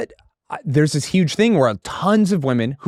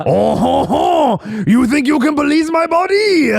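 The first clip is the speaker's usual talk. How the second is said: high, hammed-up French accent, louder; mock French accent; 13 semitones higher, 4 dB louder than usual. Mocking a defensive woman in a mock French accent. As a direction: sneer it like a snooty French lady. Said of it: "read it in a French accent".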